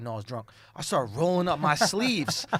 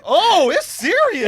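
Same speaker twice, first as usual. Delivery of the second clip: startled; 10 semitones higher, 7 dB louder, slower than usual. Retold or imitated. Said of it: "I'm like".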